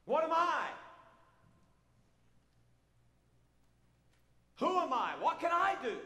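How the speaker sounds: loud, high pleading questions from the pulpit; fiery preaching. Acted. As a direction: cry out each question to the congregation. Preaching full force.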